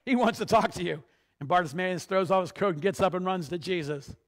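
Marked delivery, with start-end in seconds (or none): 0.0-1.0 raised, higher voice hailing someone; excited shout to get someone's attention; crowd calling out. Acted. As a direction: call over to him loudly. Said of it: "the people are saying".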